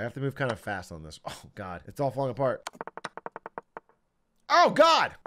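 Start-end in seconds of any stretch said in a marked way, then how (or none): 4.5-5.1 loud, high outburst; high-pitched yell of alarm; alarmed cry. Real, not acted. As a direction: cry out in sudden panic. Panics as game falls apart.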